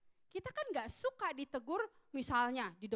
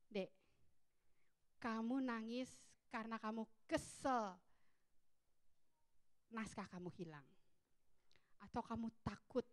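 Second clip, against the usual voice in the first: gentle questioning; 4 semitones lower, 7 dB quieter than usual. Retold or imitated. Mother gently questions crying son.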